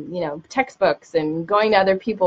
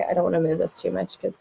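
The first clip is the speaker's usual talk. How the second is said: normal talking voice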